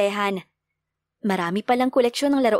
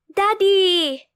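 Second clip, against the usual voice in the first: put-on high, louder cry of a little kid; excited child shout; 9 semitones higher, 4 dB louder than usual. A narrator's reading. Child running to father, calling out happily. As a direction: call out with joy like a small child.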